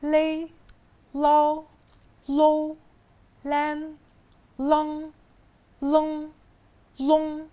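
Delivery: each syllable said slow, high and clear, one by one; pronunciation demo, syllable by syllable; syllable drill. Shown as a demonstration. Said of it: "ال ال هينطق لاي".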